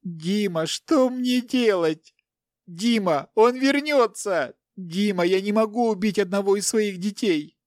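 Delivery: high, wailing plea; tearful. A narrator's reading. Captive wife begs her husband for help, tearful. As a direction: plead desperately through tears. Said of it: "сквозь слёзы обратилась она".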